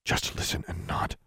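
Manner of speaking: stern whispered command; hard, soft whisper. A narrator's reading. Sister instructing Alexandra in secret, hard whisper. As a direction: whisper it firmly, allowing no reply. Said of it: "her voice was hard, even at such a soft volume".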